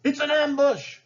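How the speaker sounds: hammed-up panicked shriek of a greedy governor. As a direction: shriek the alarm like a frightened governor. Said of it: "shrieked Ratcliffe".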